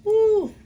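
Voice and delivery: high grossed-out ooh; high squeal of disgust. Real, not acted. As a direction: squeal at the egg. Reacts to something in egg.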